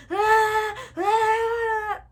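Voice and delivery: high, loud mock wails; crying scream. Child caught before a whipping, imitated crying scream. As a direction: let out hammed-up bawling screams. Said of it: "I'm screaming and I'm crying".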